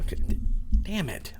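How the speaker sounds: thump of hand on microphone; mic bump. Real, not acted. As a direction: knocks the mic mid-sentence. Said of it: "I keep hitting the microphone and shaking things".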